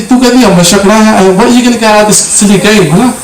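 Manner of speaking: high, exasperated nagging; retold scolding. Retold scolding of mum over game.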